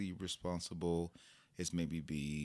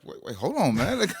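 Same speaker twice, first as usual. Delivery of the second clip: high, loud laughing protest; laughing backdown; 12 semitones higher, 8 dB louder than usual. Retold or imitated. Father realizing his son has a point, laughing. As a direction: crack up while saying hold on.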